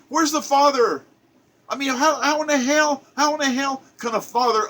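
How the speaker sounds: high, outraged demand for the missing father; outraged cry. Real, not acted. Railing at the absent father, high and indignant. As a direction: throw the question up in disbelief.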